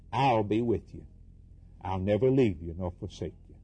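ordinary talk, nothing marked

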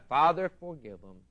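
usual speaking voice

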